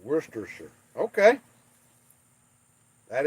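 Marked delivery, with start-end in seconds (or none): 0.0-0.7 Worcestershire said softly as "WISS-ter-sher"; quiet Worcestershire try. Shown as a demonstration. Praised for saying it right, he quietly tries Worcestershire. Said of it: "pronounces Worcestershire correctly".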